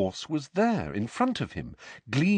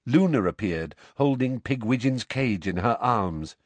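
ordinary delivery

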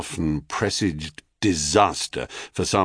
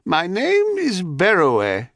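put-on high, slow old woman voice; sweetly creaky crone; 11 semitones higher, slower than usual. A narrator's reading. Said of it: "said the old woman".

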